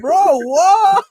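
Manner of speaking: high, loud startled bro what; baffled. Retold or imitated. Reacting to his raging friend, high startled disbelief. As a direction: throw it out in disbelief.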